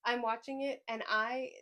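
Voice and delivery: usual speaking voice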